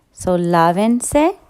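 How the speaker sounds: lávense, slow with the stress on LÁ; lávense said slowly, LÁ stressed. Shown as a demonstration. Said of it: "put the accent on the third to last syllable".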